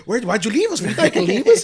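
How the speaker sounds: high, loud imitation of rowdy friends; loud group hollering. Friends burst in demanding why they were left, loud imitated hollering. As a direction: holler it like the whole rowdy crew. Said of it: "It's loud. Everybody's like".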